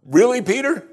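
high, rising disbelieving question; acted disbelief, pitch climbing sharply; mock incredulity. Jesus to flailing Peter, incredulous.

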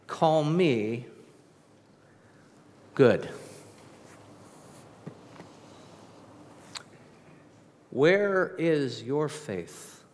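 slow, weighty questions with long pauses; Jesus asking slowly, inviting reflection; slow searching questions. Acted. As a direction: let each question hang.

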